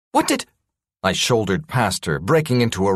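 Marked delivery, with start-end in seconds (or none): 0.1-0.5 startled. Acted. Scientist startled, cut off.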